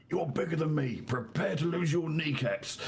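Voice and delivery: gleeful battle taunt; shouting dwarf. Acted. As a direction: taunt a bigger foe mid-charge. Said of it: "him shouting".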